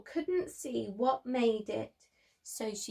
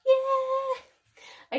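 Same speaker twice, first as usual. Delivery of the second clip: very high, bright yeah; squealed yeah; excited cheer; 14 semitones higher, 5 dB louder than usual. Real, not acted. Cheer after the plug.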